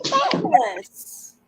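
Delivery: show-host flourish; drawn-out fabulous. Real, not acted. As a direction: savor it like a diva. Greeting guests theatrically.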